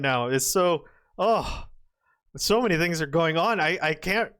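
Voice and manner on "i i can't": normal talking voice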